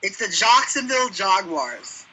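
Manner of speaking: Jacksonville Jaguars said slowly as "Jocksonville Jogwars"; mock mispronunciation. Co-host teasing Carl's pronunciation, Jacksonville Jaguars drawn out as "Jocksonville Jogwars". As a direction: turn both "Jack" and "Jag" into "ah" sounds in Jacksonville Jaguars. Joking on the pronunciation just discussed.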